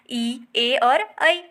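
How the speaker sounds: ई, ए and ऐ sounded out very slowly, each held; slow vowel demo. Shown as a demonstration. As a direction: hold ई, ए, ऐ clearly for students. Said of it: "जीभ का आगे का भाग काम करेगा".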